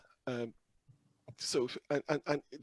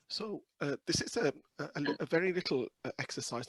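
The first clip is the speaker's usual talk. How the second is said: croaky voice; 5 semitones higher, 10 dB louder than usual. Real, not acted. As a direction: speak through a croaky throat. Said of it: "Your, your croaky voice is".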